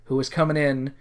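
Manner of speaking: ordinary talk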